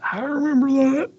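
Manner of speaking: put-on high, quavering old man voice. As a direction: say it like an old-timer. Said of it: "Very good old man voice".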